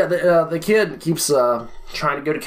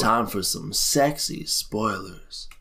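sultry announcer voice; 6 dB quieter, much slower than usual. Pretend. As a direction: ham up a seductive announcer.

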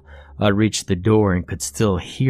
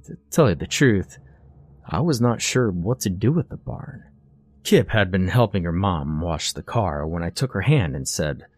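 ordinary delivery

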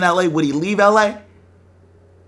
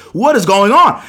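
loud, higher-pitched urgent question; retold alarmed demand; 6 semitones higher, 10 dB louder than usual. To the neighbor knocking, retold alarmed demand. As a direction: act out freaking out at the door. Retelling freaking out at the door.

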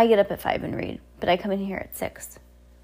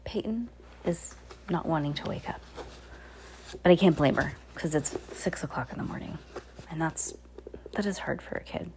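softer, hushed aside with a whisper at the end; 3 semitones lower, 7 dB quieter than usual. Real, not acted. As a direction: keep it down so she can doze. Child still half asleep beside.

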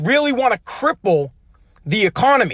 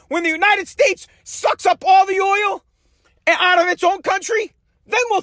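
passionate shouting; 8 semitones higher than usual. Real, not acted. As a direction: yell it, fed up and furious. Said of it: "this is how passionate I get".

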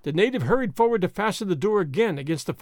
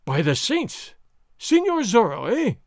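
higher, louder startled cry; 5 semitones higher, 4 dB louder than usual. Acted. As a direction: blurt it in shock. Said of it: "Gonzalez cried".

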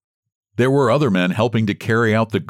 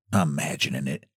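low, much softer mutter under her breath; 6 semitones lower, 7 dB quieter, faster than usual. A narrator's reading. Margaret muttering to herself at the red glow. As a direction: mutter it to yourself, low and soft. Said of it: "muttered she".

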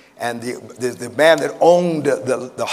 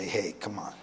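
very low, hushed coaxing; tempter's whisper; 6 semitones lower, 15 dB quieter than usual. Acted. Tempter at the listener's ear, whispering persuasion. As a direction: coax softly, close in the ear. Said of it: "whisper in our ear and say".

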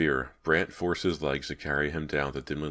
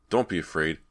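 raised voice building in pitch and volume; urgent, mildly shouted reassurance; shouting; 6 semitones higher, 5 dB louder than usual. Acted. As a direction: call reassurance through the wall. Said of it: "Brant shouted".

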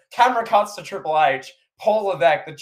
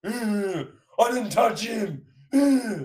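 higher-pitched mock protest; Paul Levesque; 5 semitones higher, much slower than usual. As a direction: whine innocence like a scolded wrestler. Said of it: "getting yelled at by this referee".

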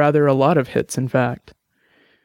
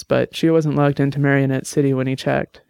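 ordinary delivery, nothing marked

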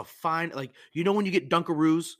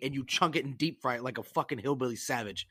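ordinary talk

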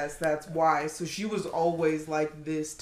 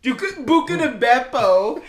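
fake Italian; 5 semitones higher, 9 dB louder than usual. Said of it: "fake Italian gibberish".

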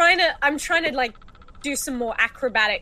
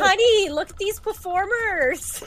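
tourist; 6 semitones higher, 4 dB louder than usual. Pretend. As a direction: marvel to your spouse at the street show. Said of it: "Some tourists".

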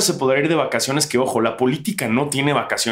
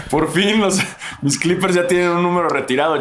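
excited; 6 semitones higher than usual. Real, not acted. Clippers fan celebrates retired number.

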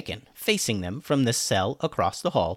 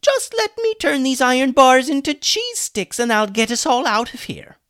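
mocking; 8 dB louder than usual. Hummus mocking Julian's idea, sarcastic. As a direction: play dumb, dripping with sarcasm. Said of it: "mocked Hummus".

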